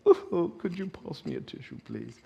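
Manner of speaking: a dainty, mock-tearful request; mock polite sniffle. Shown as a demonstration. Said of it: "I'm not saying".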